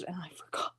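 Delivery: breathy whisper, almost to herself; whispered aside. Real, not acted. Admitting to viewers she forgot peppers, whispered. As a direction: sneak the admission out under her breath.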